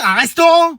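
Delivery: RESTAURANT pitched high as a question; incredulous echo. Acted. Adult echoing student's plan, incredulous high "restaurant". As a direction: throw "restaurant" back in high disbelief. Echoes ambition in disbelief.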